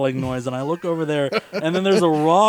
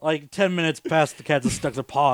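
ordinary delivery

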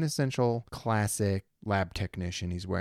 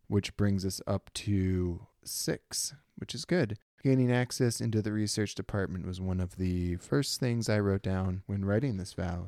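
usual speaking voice

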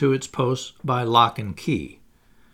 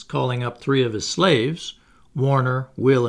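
plain reading voice

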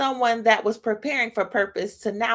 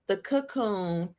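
normal talking voice

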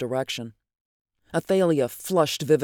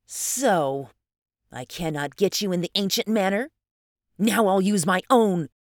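menacing suitor; 5 semitones higher than usual. Acted. Adam, refused by Athalia, turns forceful and threatening. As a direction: build from questioning to a forceful resolve.

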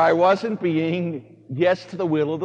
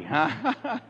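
ordinary talk, nothing marked

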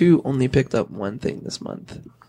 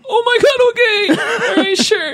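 much higher and louder, laughing; excited squeal; 4 dB louder than usual. Retold or imitated. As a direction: gush in giddy disbelief. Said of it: "and I was like".

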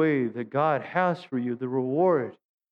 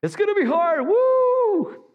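much higher, loud mock cheer ending in a whoop; mock hype; 5 dB louder, slower than usual. Pastor mocking his own gloomy sermon, loud high mock cheer. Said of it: "Boy, what an inspiring sermon".

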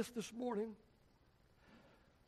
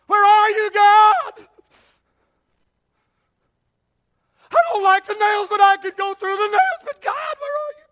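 much higher, shouted plea; loud anguished plea to God; anguished Jesus cry; 9 semitones higher, 15 dB louder than usual. Acted. Voicing Jesus crying to God.

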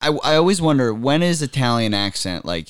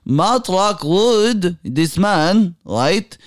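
put-on high, slow, half-chanted Middle Eastern accent; 10 semitones higher than usual. Guest hailing Matt as saviour, high chanting Middle Eastern accent.

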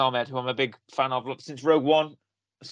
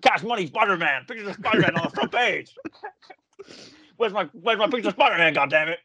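cigar in mouth, higher, gruff newspaper boss; J K Simmons impression; 4 semitones higher than usual. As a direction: bark it like an editor chewing a cigar. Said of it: "mime like I've got a cigar in my mouth".

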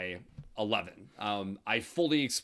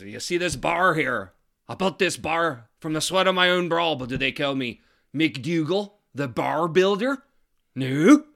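louder, higher aggrieved complaint; indignant old Irishman; 6 semitones higher, 9 dB louder than usual. Acted. Old Irish barman complaining to the young man, loud and aggrieved. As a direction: build the grievance to a loud No.

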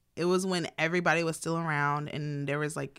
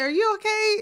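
high, louder worried question; concerned check; 8 dB louder than usual. Retold or imitated. Family asking the fallen uncle, high and worried. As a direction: ask it anxious and up high. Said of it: "We said".

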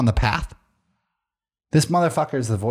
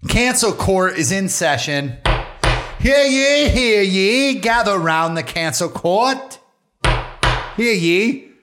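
town crier; 5 semitones higher, slower than usual. Pretend. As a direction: proclaim it like a herald. Mock court crier opening.

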